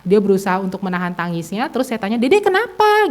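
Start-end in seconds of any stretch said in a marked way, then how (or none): 2.2-3.1 put-on high-pitched parental question to a small child; concerned parent asking. Mother to her tearful child at the car, high concerned question. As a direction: lift the voice high to ask the child.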